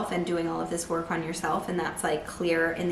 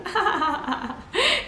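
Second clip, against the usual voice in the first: high, giggly burst of laughter; laughing; 11 semitones higher than usual. Real, not acted. Tarot reader seeing inner children laughing, high giggle. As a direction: laugh in delight.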